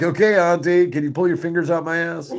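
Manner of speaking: hammed-up plea to the auntie; loud mock request to the nurse auntie; mock pleading.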